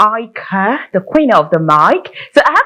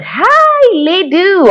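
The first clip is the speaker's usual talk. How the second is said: calling out; 11 semitones higher, 3 dB louder, much slower than usual. Real, not acted. Host calls guest on bad line.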